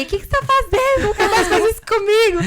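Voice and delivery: loud, very high outraged outburst; retold angry yelling. Wife just released by husband, yelling at him in retold fury. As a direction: re-enact blowing up at someone at full pitch. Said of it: "E eu comecei a gritar".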